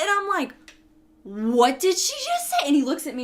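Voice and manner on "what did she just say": slow, higher, baffled question; mock bewilderment. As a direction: act out being stunned, stretching the words. Stunned reaction to garbled order.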